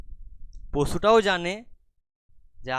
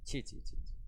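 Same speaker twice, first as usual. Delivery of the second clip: tutting; 10 dB quieter than usual. Acted. Tutting disgust.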